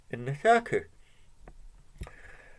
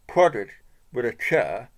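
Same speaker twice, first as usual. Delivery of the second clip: plain reading voice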